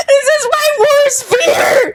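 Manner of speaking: high, drawn-out laughing wail; stressed out. Real, not acted. Put on the spot for an accent, stressed wail. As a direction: wail it in real, giggly panic. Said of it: "it stresses me out".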